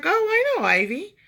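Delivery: high, eager gush of recognition; excited recognition. Retold or imitated. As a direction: squeal it out like you know her well. Friend of nail girl recognizes Ivy.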